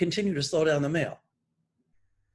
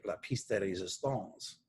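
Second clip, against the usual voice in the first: bad French accent; 6 semitones lower, 9 dB quieter than usual. Shown as a demonstration. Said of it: "Pardon my bad accent in French".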